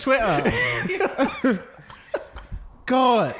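high, slow, laughing; can't stop laughing at the pun. Real, not acted. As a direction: squeal it out laughing. Cracking up at joke.

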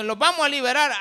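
proclaiming. Acted. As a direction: proclaim it loudly as Moses. Preacher voices Moses proclaiming liberation.

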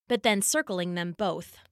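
usual speaking voice

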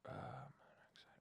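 breathy whispered word. Real, not acted. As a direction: whisper it to yourself. Muttering a correction.

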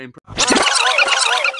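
high squeaky crack on impre; squeaky voice break. Real, not acted.

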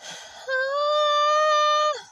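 sudden high, loud drawn-out scream. Real, not acted. Vlogger screaming into the camera, a loud scream. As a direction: let out a full scream into the mic. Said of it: "sorry for screaming".

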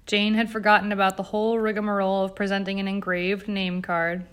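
ordinary delivery, nothing marked

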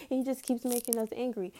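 ordinary talk, nothing marked

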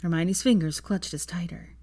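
ordinary delivery

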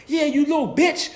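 lightly voiced foul-mouthed kid; a hint of a swearing little child; cussing kid. Imitating cussing six-year-olds.

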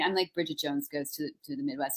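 ordinary talk, nothing marked

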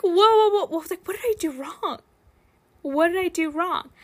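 high-pitched, flustered alarm; mock panic. Babysitter retelling the potty mishap, mock panic. Acted panic as the toddler sprays.

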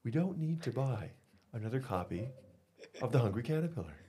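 soft hushed librarian voice; library whisper. Shown as a demonstration. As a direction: speak softly like a librarian. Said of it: "is my library voice".

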